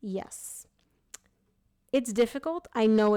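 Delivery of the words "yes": whisper. Real, not acted. Quiet aside while reading cards.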